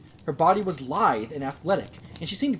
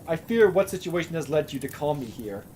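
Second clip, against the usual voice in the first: plain reading voice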